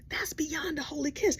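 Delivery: hushed scandalized whisper; whispered aside. Real, not acted. Whispering to the audience about the kiss, scandalized. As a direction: lean in and whisper it.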